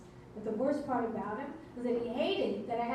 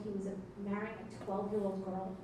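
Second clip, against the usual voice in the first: normal talking voice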